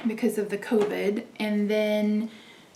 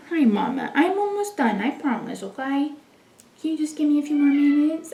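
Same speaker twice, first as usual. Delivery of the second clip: talking to a pet; 5 semitones higher than usual. Real, not acted. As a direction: coax the cat sweetly. Talking to her cat.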